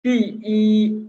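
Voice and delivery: पी then ई held long, high and level; unchanged first-tone ई. Shown as a demonstration. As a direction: draw ई out slowly on one high note. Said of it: "tone कभी change नहीं होगी". (पी ई में ई लंबी, ऊँची और सपाट)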